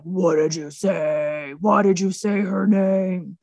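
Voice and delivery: slow, hammed-up movie-hero voice; mock dramatic demand. King overhearing, as Batman to Superman, mock-dramatic. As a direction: stretch each word in mock outrage.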